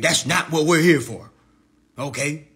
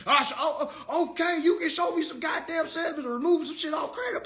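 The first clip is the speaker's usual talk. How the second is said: high sneering mock voice; mocking Vance; 6 semitones higher than usual. Imitating Vance mocking the students, pitched up. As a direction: lampoon him, pitched up. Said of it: "He gonna hop on here and say".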